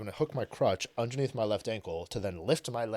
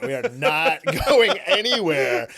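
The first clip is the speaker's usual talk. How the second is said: louder, laughing protest; drunken loud refusal; 5 semitones higher, 10 dB louder than usual. Retold or imitated. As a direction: wave it off, tipsy and loud.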